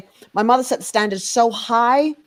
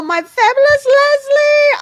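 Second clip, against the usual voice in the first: excited; 6 dB louder, slower than usual. Real, not acted. Hyped introduction of co-host.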